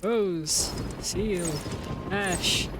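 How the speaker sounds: solemn recital, word by word; put-on reading voice. Real, not acted. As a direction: read each word on its own in an odd put-on voice. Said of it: "I don't know why I'm using this voice".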